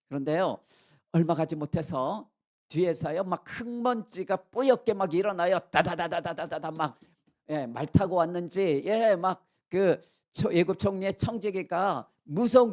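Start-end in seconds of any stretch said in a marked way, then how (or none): 5.7-6.7 rapid-fire vocal hoofbeats; imitated galloping hooves. Pursuers riding up behind the brothers, imitated hoofbeats. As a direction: rattle it off like horses galloping.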